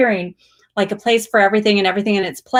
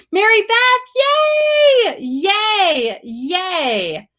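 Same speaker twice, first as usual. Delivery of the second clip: cheering yay; 12 semitones higher, much slower than usual. Real, not acted. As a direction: cheer Mary Beth on. Cheering a viewer by name.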